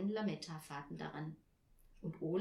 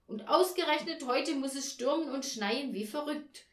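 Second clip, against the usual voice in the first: higher, louder, aggrieved rant; snapping tirade; 5 semitones higher, 6 dB louder than usual. A narrator's reading. Mother to Olaf about her ruined evening, snapping tirade. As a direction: pour out the frustration, raised and forceful. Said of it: "fuhr Frau Janka ihn an".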